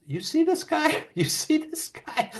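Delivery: high, put-on voice of Johnny; high mock disbelief, laughing through it; Johnny's voice. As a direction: mimic Johnny's amazed disbelief. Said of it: "and he goes".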